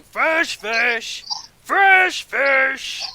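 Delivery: loud, high market hawker's cry; yelling fish seller. Acted. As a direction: holler it out to peddle your fish. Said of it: "He's just yelling, trying to peddle fish".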